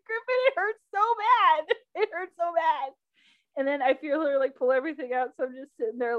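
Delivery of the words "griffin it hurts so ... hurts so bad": high, whimpering mock wail; acted-out tearful plea; retold crying. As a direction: whine it high like a hurt child. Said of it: "just crying".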